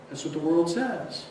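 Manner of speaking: ordinary talk, nothing marked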